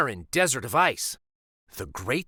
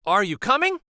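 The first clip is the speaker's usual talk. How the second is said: conductor voice; 7 semitones higher, 5 dB louder, faster than usual. Acted.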